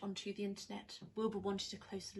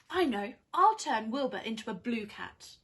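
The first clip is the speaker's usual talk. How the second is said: gleeful idea; 9 dB louder than usual. Acted. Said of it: "Winnie gets an idea".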